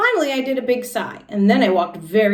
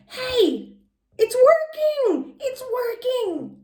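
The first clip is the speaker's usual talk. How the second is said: very high, laughing excitement; delighted squeal; much slower than usual. Acted. Junie B. thrilled as her grin finally appears. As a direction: squeal with joy.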